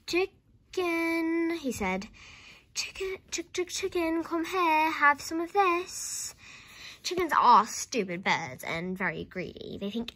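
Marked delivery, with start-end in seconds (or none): 0.1-1.5 high, drawn-out animal call; coaxing. A narrator's reading. George calls the hen to the spoon, coaxing. 2.7-6.3 high sing-song hen call; coaxing. A narrator's reading. George offers the hen a spoonful, coaxing.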